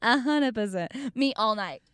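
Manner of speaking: usual speaking voice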